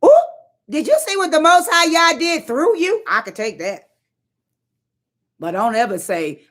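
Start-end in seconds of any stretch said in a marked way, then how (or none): 0.0-3.0 gushing praise. Retold or imitated. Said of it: "I'm gonna need you to say".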